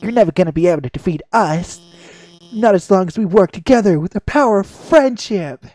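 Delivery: hammed-up heroic speech; defiant rescuer. Rescuer standing up to the robot villain, hammed-up defiance. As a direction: stammer a little, then build to a rousing finish.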